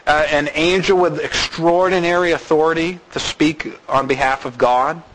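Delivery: ordinary talk, nothing marked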